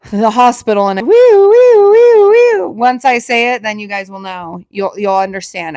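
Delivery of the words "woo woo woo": high loud woo woo like an ambulance siren; siren imitation. Shown as a demonstration. Showing viewers the word she can't say, siren woo. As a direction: wail it like a siren. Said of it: "can't say the thing that leaves".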